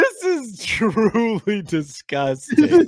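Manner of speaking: slow high disgusted; disgusted drawl. Real, not acted. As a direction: drag it out in disgust. Watching gross eating.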